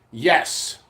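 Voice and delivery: ordinary talk, nothing marked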